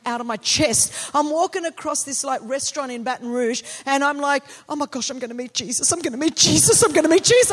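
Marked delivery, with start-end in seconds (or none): 4.7-7.5 freaking out. Retold or imitated. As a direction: re-enact mounting starstruck panic. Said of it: "I'm, like, freaking out".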